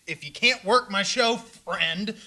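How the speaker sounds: pleading. Acted. Host begging his friend Chad to work his show. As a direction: stretch it out, begging.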